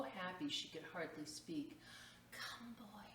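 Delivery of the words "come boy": put-on soft, hushed call to the boy; whispered invitation. A narrator's reading. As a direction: draw him close, barely above a breath. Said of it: "she whispered".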